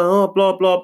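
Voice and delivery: high mocking blah blah; mock rude customer. Guard mocks the rude customer with high blah blah. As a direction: mimic her rude whining. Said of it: "getting rude".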